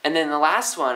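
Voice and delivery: ordinary talk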